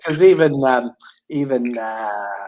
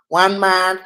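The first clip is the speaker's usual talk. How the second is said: higher, whiny imitation; Dan's whiny voice; 6 semitones higher than usual. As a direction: whine it out, pitched up. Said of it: "you had to have Dan's whiny voice".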